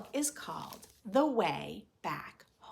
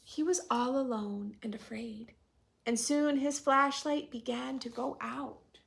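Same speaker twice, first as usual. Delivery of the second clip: storytelling voice; 4 semitones higher than usual. A narrator's reading. Reading picture book to class.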